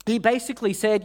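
ordinary talk

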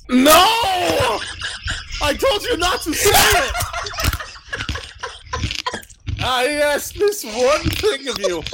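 high, laughing mock outrage; mock outraged shout. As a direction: shout it up high in fake betrayal.

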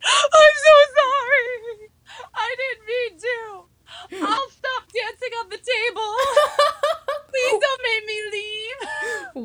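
high, slow, hammed-up sobbing plea; fake-sob a desperate apology; mock crying. Pleading to security guard, hammed-up crying. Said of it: "I'd just start crying".

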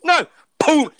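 usual speaking voice

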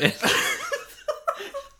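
high burst of laughter. Real, not acted. Laughing at interrupting voice idea.

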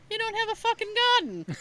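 a dry jab from the gruff father; blunt dad. Retold or imitated. Daughter quoting her dad's put-down, mock gruff.

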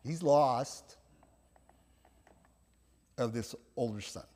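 ordinary talk

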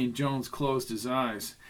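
usual speaking voice

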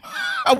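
shrieking cackle way up high; high shrieking laugh. Real, not acted. Cracking up at her own nurse impression, shrieking laugh. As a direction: let out a squealing laugh.

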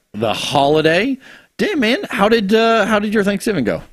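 ordinary talk

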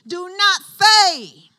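very loud, high, drawn-out command; forceful preacher's shout. Acted. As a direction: thunder it slowly as God's order. Preacher voices the Lord's command.

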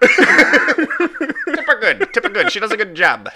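put-on high, drawn-out old-timey gent; a hammy old-fashioned customer. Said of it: "old-timey voice".

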